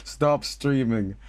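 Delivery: drawn-out slow deadpan plea; slow mock plea. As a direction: beg slowly and dryly. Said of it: "mock plea".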